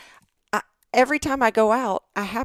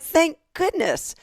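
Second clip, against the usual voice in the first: relieved; 10 semitones higher, slower than usual. Retold or imitated. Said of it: "I'm like".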